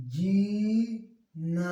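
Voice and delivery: drawn-out, melodious syllables; very slow cuckoo-sweet demo. Shown as a demonstration. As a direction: stretch जी long and smooth, then ना. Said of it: "तो जरा बोलो ये मीठी आवाज में".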